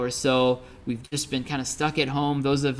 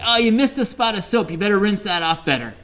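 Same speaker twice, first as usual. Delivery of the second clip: roommate lightly imitated, higher pitched then easing; mock helpful pointers; 5 semitones higher, 3 dB louder than usual. Roommate giving pointers on brushing, mock helpful. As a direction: imitate a roommate offering unwanted tips. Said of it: "Chip would give me pointers on this".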